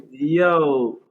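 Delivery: ordinary delivery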